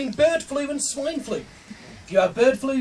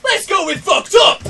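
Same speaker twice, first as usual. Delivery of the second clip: loud, higher, slowed-out yell; gleeful crowd shout; 6 semitones higher, 6 dB louder, slower than usual. Retold or imitated. Audience members pushing for sick jokes, shouted. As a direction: mimic a crowd demanding the worst. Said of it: "The people that come here are just going".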